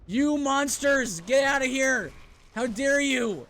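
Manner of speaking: furious high shout; shaking with anger. Real, not acted. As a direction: yell in real frustration. Yelling at enemies.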